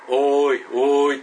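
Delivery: loud drawn-out call; eerie voice creeping closer; approaching call. Retold or imitated. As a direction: unseen voice coming nearer, loud. Voice drawing nearer.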